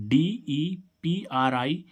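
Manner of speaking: letters spelled out very slowly; slow dictation for students; slow spelling. Shown as a demonstration. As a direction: spell डीईपीआरआई भी ई डी letter by letter, drawn out. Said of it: "इसमें डी जोड़ रहे हैं".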